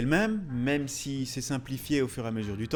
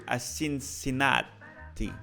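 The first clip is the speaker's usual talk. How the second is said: mock Italian lilt on the city name; hammed-up Italian accent, drawn out; much slower than usual. Vlogger naming the logo's street city, mock Italian accent. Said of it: "je prends cet accent italien".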